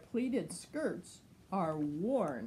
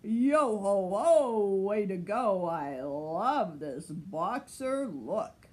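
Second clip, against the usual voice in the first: pirate captain; 6 dB louder than usual. Acted. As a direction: roll it out like a swaggering captain. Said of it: "said Pirate Captain Hook".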